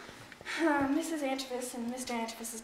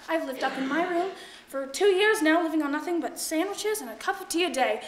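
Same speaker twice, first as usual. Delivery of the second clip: normal talking voice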